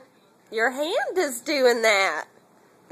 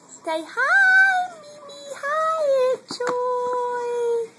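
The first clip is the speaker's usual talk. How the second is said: put-on much higher sing-song voice for the baby; baby-talk greeting; 11 semitones higher, slower than usual. Mother prompting baby to greet Mimi and Aunt Joy, high coo.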